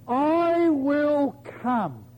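"I" held long and high in "I will come"; stress on I. Shown as a demonstration. Preacher stressing God's promise to Sarah, "I" drawn out emphatically. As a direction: draw out "I will come", very slow, leaning on "I". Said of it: "show you where the emphasis should be".

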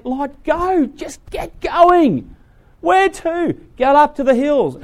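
high, loud pressing command; urgent angels. Acted. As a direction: push him on, high and loud. Angels and Lot exchange.

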